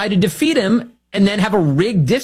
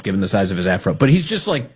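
usual speaking voice

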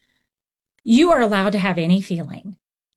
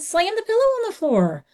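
put-on higher, upbeat instruction to a little kid; encouraging a child; 10 semitones higher than usual. Counselor urging an angry boy to slam a pillow, high and encouraging. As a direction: egg the boy on to let it out. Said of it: "Handed him a pillow and I said".

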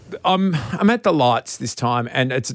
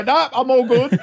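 laughing brush-off; 8 semitones higher than usual. Retold or imitated. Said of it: "where you trip and you go".

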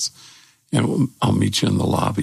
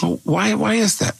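curious wonder; 12 semitones higher than usual. Retold or imitated. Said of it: "where I kinda went".

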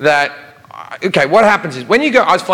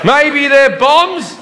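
high, slow, playing dumb; drawn-out innocent shrug in a high voice; mock innocent; 10 semitones higher, much slower than usual. Speaker plays innocent to guard.

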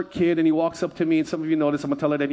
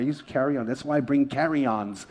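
ordinary delivery

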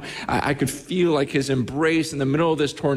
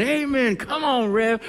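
high-pitched imitation of an eager congregant; mock amen-corner call, pitched way up; Sandy; 10 semitones higher than usual. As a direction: call out like a churchgoer cheering the preacher on. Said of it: "it was always Sandy".

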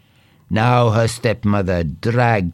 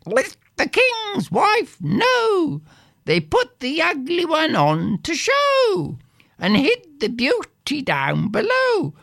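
crowing rooster. Acted. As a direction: crow it like a rooster. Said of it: "began to crow".

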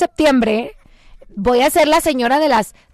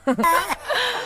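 bright burst of laughter; short amused laugh; 5 semitones higher, 6 dB quieter than usual. Real, not acted. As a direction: laugh at your own joke. Laughs at own joke.

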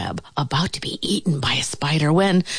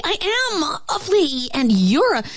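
high, indignant, searching for an insult; sputtering flea; 9 semitones higher than usual. A narrator's reading. Flea nearly insults ant, high indignant sputter. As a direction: sputter in a high voice, holding back.

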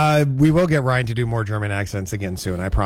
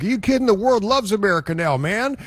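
excited friend; 8 semitones higher than usual. Retold or imitated. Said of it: "and he's like".

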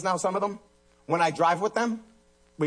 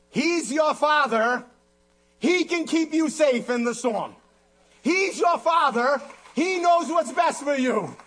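high, measured proclamation; forceful preaching; 10 semitones higher, 3 dB louder, slower than usual. Real, not acted. Preacher assuring congregation of the Father's care, forceful preaching. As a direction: drive each phrase home to the congregation.

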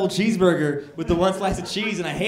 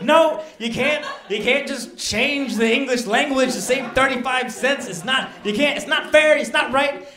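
higher, louder, slowed protest at Greg; outraged complaint; 8 semitones higher, 6 dB louder than usual. Retold or imitated. Caller to corporate protesting the single slice, loud outrage. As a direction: plead indignantly that it's not fair. Arguing with corporate over cheese.

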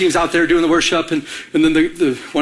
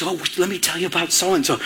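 hushed, softer confiding voice; gossipy whisper; 5 dB quieter than usual. Acted. Gossip approaching a believer about so-and-so, hushed and whispery. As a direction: lean in with a mock whisper. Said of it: "comes to you and is whispering".

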